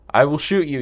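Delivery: a stagey villain's shout; threatening yell. Acted. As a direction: yell it at her down the gun barrel. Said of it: "you yell".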